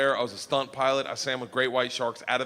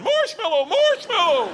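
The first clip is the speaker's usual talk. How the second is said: high, loud desperate calling out; screaming the safe word for rescue; frantic shouted safe word; panicked yell; 7 dB louder than usual. Retold or imitated. Being ragdolled, calling for help.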